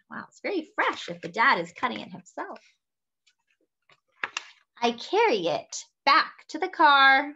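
slow, clear storybook reading; reading to children. A narrator's reading. Host reading a picture book to children, bright and measured. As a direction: read it warmly to little listeners.

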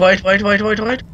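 frantic wait, wait; rising panic. Real, not acted. Panicked at game noise.

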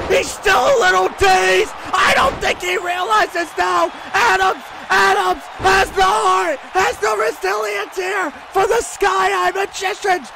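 high, urgent shouted commentary; excited play-by-play. Real, not acted. Commentator calls Adams's comeback, shouting high and excited.

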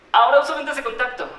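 más agudo y fuerte, de golpe; aclaración exaltada. Acted. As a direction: interrumpe tu relato con una aclaración en voz alta. (aclaración exaltada, en voz alta)

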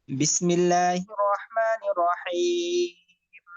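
slow melodic tajweed chant; Quran recitation. Shown as a demonstration. Teacher reciting for students in slow tajweed chant. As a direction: recite slowly, drawing out each vowel.

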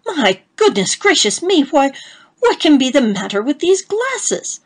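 high and loud shocked cry; rising panic over the glasses; alarmed outburst. A narrator's reading. Husband baffled by the spectacles, loud high alarm.

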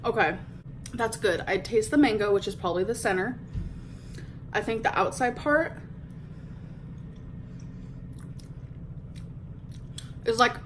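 speaking between bites; talking while chewing. Real, not acted. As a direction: mouth partly full of food. Seen 2: Bites mochi before; mouth works between words; bites again 5.5.

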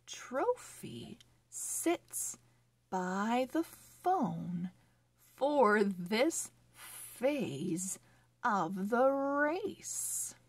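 slow, careful storybook reading; reading to children. Acted. Teacher reading a phonics story to children, slow and clear. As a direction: read each word clearly for young readers.

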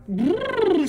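high trilled elephant noise; playful high trumpeting trill. Shown as a demonstration. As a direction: imitate an elephant for a child.

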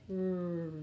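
R as a held "rrr"; letter sound. Shown as a demonstration. Teacher drilling letter sounds, R as "rrr". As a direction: hold R low as "rrr". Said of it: "R, rabbit".

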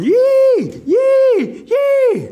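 very high, loud "yee" squeals; dancing Rabbit whoops. A narrator's reading. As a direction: whoop shrilly, each cry held long. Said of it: "here's Rabbit out there going".

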